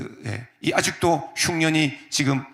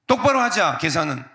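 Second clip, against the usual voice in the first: higher and louder, sharp demand; mock demand; 5 semitones higher, 3 dB louder than usual. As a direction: insist on settling the score. Mock demanding fair share. (조카에게 계산 따지며 언성 높여)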